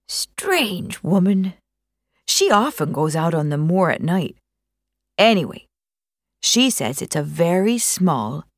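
lightly voiced old country neighbour; a hint of a gossipy old moor man; old Dartmoor countryman. Old neighbour Bill passing on gossip, lightly voiced countryman.

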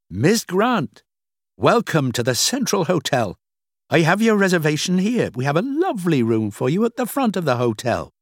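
high-pitched, obliging receptionist; welcoming hotel clerk. Acted. Clerk checking Rachel into the hotel, welcoming high voice. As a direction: welcome the guest in a high, courteous voice.

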